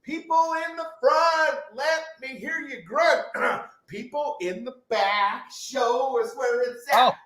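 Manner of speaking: high-pitched rallying call; crowd hype call. Acted. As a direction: work the front and back rows like a concert. Mock concert crowd call.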